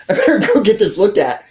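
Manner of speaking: high then laughing resignation; rueful laughing. Retold or imitated. Realizing he must see a doctor, rueful laugh. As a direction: laugh it off, half worried.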